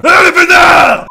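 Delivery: very high, loud slow shriek; shrieked outrage. Acted. Reader mocking the furious heroine, shrieking. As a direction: screech it out.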